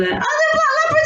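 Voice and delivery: high-pitched gush over a dog; excited dog greeting. Retold or imitated. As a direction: squeal happily at the Labrador.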